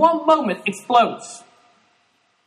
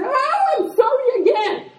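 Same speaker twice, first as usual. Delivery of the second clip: high singsong sorry; hammed-up high apology; mock sweet apology. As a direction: apologise sweetly yet again. Another sugary apology.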